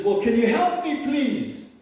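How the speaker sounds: high-pitched, hammed-up plea; mock pleading. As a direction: beg like the stranded man in a joke. Hanging man pleads with God.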